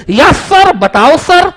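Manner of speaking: high, loud, eager student calling sir; a keen pupil calling out to get the teacher's attention; eager student Champat. Retold or imitated. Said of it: "Champat बोले". (उत्सुक छात्र की ऊँची आवाज़)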